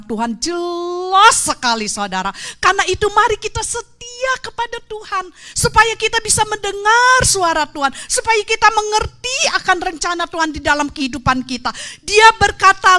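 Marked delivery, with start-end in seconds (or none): 2.6-11.7 high, rousing call to the church; preaching exhortation. Acted. Preacher urging congregation to be faithful, rousing exhortation. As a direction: urge them to be faithful.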